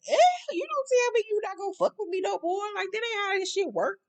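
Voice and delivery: very high, hammed-up protest; mock heartbroken. Speaker protests Tommy leaving Gloria, mock heartbroken. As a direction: plead in a squeaky, put-on heartbroken voice. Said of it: "it kinda broke my heart".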